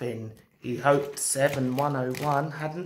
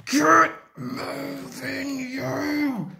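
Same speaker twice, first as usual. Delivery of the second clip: high, drawn-out effortful cry; straining command; 9 semitones higher, much slower than usual. Acted. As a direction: heave the words out slowly. Engine shouts at diesel to move.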